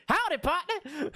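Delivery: high, whooped mock cowboy hello; hammy cowboy greeting. Streamer mocking an out-of-place game line, hammy cowboy whoop. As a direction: call it out like a cartoon cowpoke.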